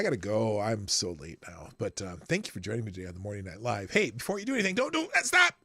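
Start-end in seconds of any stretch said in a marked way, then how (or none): none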